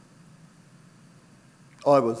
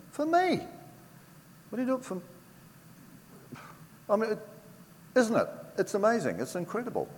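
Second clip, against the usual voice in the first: overwhelmed amazement; 12 semitones higher, faster than usual. Real, not acted. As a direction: voice leaps much higher in astonished wonder. Overwhelmed recalling God's gift.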